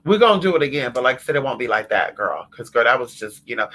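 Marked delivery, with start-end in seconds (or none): none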